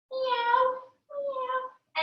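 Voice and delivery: high little kitty meows; cat meow. Shown as a demonstration. Teacher demonstrating cat pose for children, high meows. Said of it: "And then for cat".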